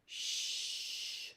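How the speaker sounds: "sh" as one soft hushing sound; teacher showing the digraph sound. Shown as a demonstration. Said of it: "That sound is a little different".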